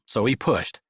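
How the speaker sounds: ordinary delivery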